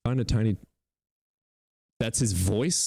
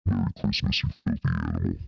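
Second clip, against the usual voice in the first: much lower, distorted voice-changer take; deep voice changer; faster than usual. Shown as a demonstration. Streamer testing voice changer, deep distorted. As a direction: drop it way down through the changer. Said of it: "I hope that worked".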